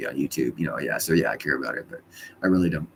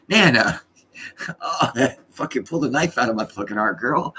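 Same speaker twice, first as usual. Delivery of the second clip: high, loud laughing complaint; hammed-up heartbreak through laughter; mock wounded; 6 semitones higher, 5 dB louder than usual. Grandson mock-wounded by Nana's joke, laughing high and loud.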